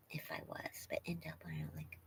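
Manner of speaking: unvoiced whisper while reading; barely audible whisper. Real, not acted. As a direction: mouth the comment to yourself. Reading comment under breath.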